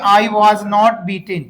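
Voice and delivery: very slow, word by word; slow model sentence. Shown as a demonstration. Teacher to his class, dictating the answer very slowly. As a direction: drag each word out for repeating. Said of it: "तो क्या बोलेंगे?".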